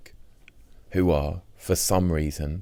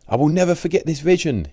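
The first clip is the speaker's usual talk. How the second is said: Richard's voice; 12 semitones higher, 5 dB louder than usual. Retold or imitated. Richard quoted, voiced high.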